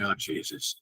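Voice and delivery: a worried whisper to oneself; whispered to himself. Retold or imitated. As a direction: breathe it out in an anxious whisper. Inner worried thought.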